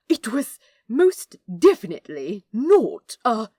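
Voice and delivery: insist, offended; indignant angel. Acted.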